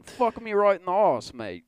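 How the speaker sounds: high, loud, hammed-up Australian accent; mock Australian accent. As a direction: ham up a crude Australian accent. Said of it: "I'd say Australian".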